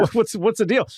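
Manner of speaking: mock a baffled juror; puzzled juror. Confused juror reacts to a lawyer listing names. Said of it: "the jurors are looking at him like".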